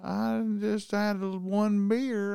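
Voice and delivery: put-on high, slow, drawn-out drunk excuse; imitated drunk man. Drunk man insisting on one beer, imitated slow high slur. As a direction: stretch it out like a drunk pleading innocence.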